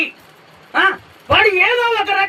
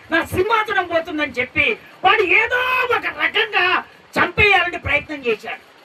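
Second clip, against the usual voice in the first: normal talking voice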